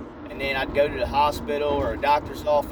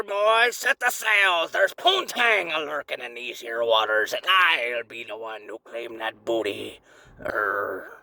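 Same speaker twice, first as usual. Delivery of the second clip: slow, growly pirate captain; hammed-up talking pirate; 2 semitones lower, slower than usual. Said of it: "my pecker sounds like a pirate".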